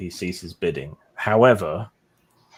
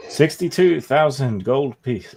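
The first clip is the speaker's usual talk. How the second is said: slow, higher announcing call; drawn out auction call; auctioneer; 6 semitones higher, 6 dB louder than usual. Acted.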